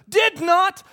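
high, loud yelled denial; imitated child's retort. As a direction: shout back like a bickering little boy. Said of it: "Cullen goes".

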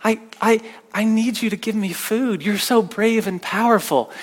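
soft, whimpering, pleading little voice; sweet whimpering dog. Retold or imitated. Pastor voicing his begging dog, soft whimpering plea. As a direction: whimper softly like a begging puppy. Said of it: "she'll come over really soft, really sweet... kinda whimper".

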